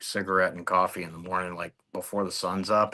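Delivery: normal talking voice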